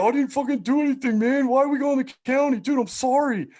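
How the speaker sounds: very high-pitched mock pleading; panicked pleading. Cellmate pleading not to go to county, mock panic. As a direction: imitate a panicky cellmate begging off.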